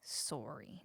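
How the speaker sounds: sorry with a long Canadian "sore-ee" vowel. Shown as a demonstration. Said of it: "say sorry the Canadian way".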